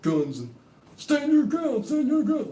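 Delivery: very high, loud American yell. Retold or imitated. Mocking shitty Americans yelling, very high and loud. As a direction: bellow like a gun nut. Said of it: "That's like".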